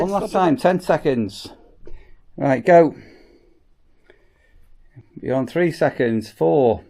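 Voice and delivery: ordinary delivery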